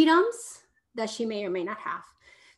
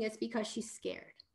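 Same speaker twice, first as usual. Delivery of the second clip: usual speaking voice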